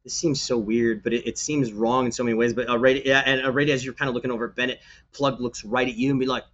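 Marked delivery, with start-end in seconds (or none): none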